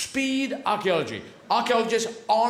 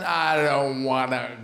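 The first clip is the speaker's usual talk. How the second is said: slow, drawn-out low groaning refusal; fed-up Russia; 6 semitones lower, slower than usual. Acted. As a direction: drag it out like a weary sulker, sinking lower. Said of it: "And then Russia went".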